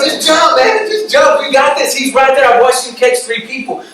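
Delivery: loud, high-pitched acted cheering; hammed-up impatient spectator pep talk; urging her on. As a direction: shout encouragement up to a hesitant jumper. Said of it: "So we can tell the girl".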